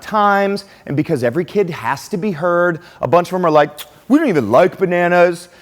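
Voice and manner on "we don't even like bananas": lightly voiced kids, higher and louder; mock classroom protest. Mimics kids shouting objections in class.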